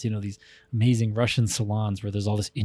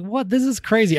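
surprised; 9 semitones higher than usual. Retold or imitated. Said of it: "it's like".

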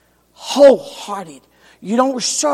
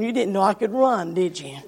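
softer, playful aside; teasing aside; 3 semitones lower, 6 dB quieter than usual. Acted. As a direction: tease them under your breath.

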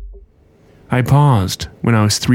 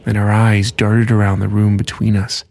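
ordinary delivery

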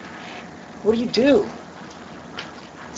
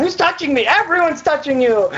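raised, high-pitched mock exchange; exasperated back-and-forth; 7 semitones higher than usual. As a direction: act out a baffled complaint and retort. Acting out Jesus and Jairus exchange.

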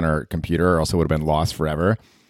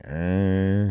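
disgusted groan; 3 dB quieter than usual. Acted.

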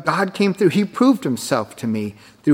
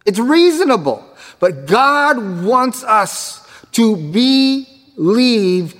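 full-force preaching; 4 semitones higher, slower than usual. Acted. Preacher urging the congregation to believe the promise, slow and soaring. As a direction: build to the sermon's peak, stretching words.

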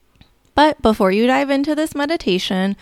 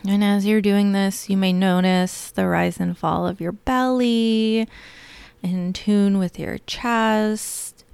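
guided meditation. Acted. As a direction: lull them gently into calm.

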